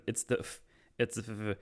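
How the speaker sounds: acted stammer, catching on the first sound; imitated stutter. Vic trying to explain himself to Oz, stuttering. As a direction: get stuck on the f. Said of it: "he starts to stutter again".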